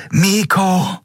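high, alarmed scream of a name; Kapitän Nagun. A narrator's reading. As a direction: cry out in horror to a falling friend. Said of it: "hörte er Kapitän Naguns Schrei". (hoher, entsetzter Schrei)

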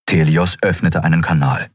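ordinary delivery

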